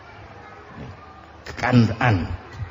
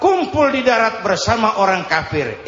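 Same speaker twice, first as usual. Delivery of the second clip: ordinary talk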